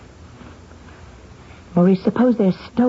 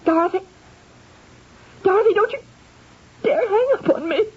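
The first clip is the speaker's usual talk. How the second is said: angry pleading; 12 semitones higher, faster than usual. Acted. Sister hangs up mid quarrel.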